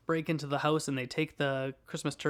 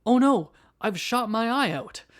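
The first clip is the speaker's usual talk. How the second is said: high, loud, panicked cry; mock panic; 8 semitones higher, 8 dB louder than usual. Vlogger quotes the film narrator after the ricochet, high panicked cry. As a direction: yelp it like sudden alarm. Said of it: "the narrator says, he goes".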